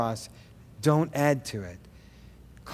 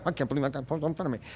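annoyed driver muttering; 8 dB quieter, much faster than usual. Retold or imitated. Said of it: "I might go".

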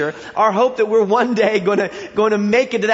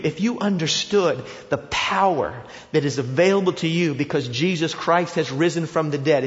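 ordinary talk

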